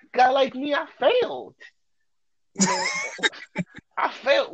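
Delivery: high pitched laughing through the words. Real, not acted. Host laughing at his own failed pickup, high and laughing. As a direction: crack up while admitting defeat. Laughing while telling failure.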